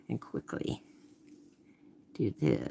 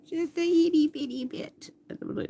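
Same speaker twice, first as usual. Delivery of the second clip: cutesy tiny voice. Real, not acted. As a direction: coo it like describing something tiny. Painter adds a tiny dab.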